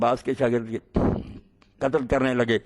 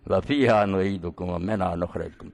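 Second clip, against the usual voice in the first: slow, lower recitation of the verse; Quranic recitation; 6 semitones lower, slower than usual. Acted. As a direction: chant the verse slowly and gravely.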